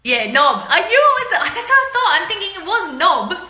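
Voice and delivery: high, loud laughing recognition; giggling delight at the word; laughing excitedly. Real, not acted. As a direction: burst out laughing as you remember.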